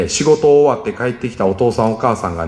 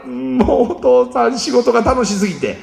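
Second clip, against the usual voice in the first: higher, laughing, overjoyed dad voice; cheerful dad; 10 semitones higher than usual. Pretend. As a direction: gush about how much fun work was. Vater kommt fröhlich nach Hause.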